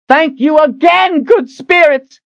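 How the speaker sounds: high furious scream. Acted. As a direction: scream it at the sky. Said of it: "she screamed at the top of her lungs".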